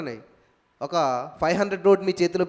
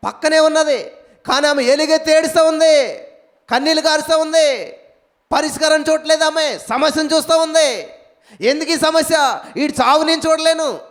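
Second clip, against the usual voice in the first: higher, louder preaching push; preaching at full force; 4 semitones higher, 5 dB louder than usual. Acted. Preacher urges the congregation, higher and louder. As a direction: drive the point home to the crowd.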